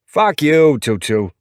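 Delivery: high, loud New York insult; New York attitude, punched out high; thick New York accent. A narrator's reading. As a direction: snap back as a feisty New Yorker. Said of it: "His New York accent is thick and full of attitude".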